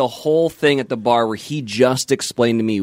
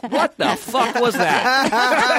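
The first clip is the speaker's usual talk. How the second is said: higher, slow drawn-out bafflement; stunned disbelief; 8 semitones higher, much slower than usual. Retold or imitated. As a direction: drag it out, totally baffled. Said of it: "And everyone's like".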